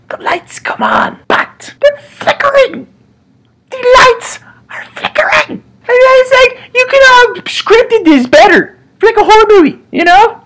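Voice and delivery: very high, whiny half-crying voice; mock whimper. Scared man on toilet whining about flickering lights. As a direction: wail in hammed-up fright.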